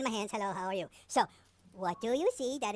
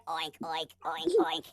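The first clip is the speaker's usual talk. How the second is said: pig voice; 9 semitones lower, slower than usual. Pretend. Piggy in a children's song.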